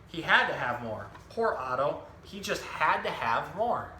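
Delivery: lively picture-book storytelling voice, each line clearly paced; reading to children. Acted. Zookeeper reading a book to children, animated storytelling. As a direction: tell the story to a room of young kids.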